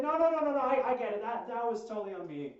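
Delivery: loud, high rush of no no no; emphatic apology. Retold or imitated. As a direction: insist it's all your fault, loudly.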